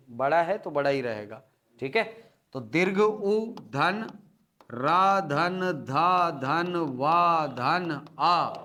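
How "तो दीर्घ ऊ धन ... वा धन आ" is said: slow spelled out. Shown as a demonstration. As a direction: spell out ऊर्ध्व piece by piece, very slowly. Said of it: "ये इसका वर्ण विन्यास होगा".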